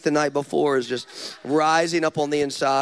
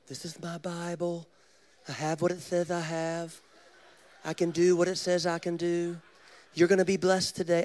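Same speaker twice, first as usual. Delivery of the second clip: Joel Osteen impression; 2 semitones higher than usual. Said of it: "you're not gonna hear this on Joel Osteen's podcast".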